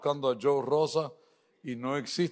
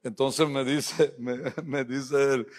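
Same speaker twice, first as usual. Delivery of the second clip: ordinary talk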